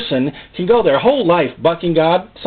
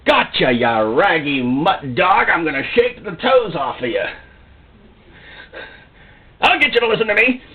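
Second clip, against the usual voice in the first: mock bully God; 3 semitones higher than usual. Preacher mocking a harsh God who grabs a stray dog. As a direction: growl it like a dog-catcher. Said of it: "he's not coming through to go".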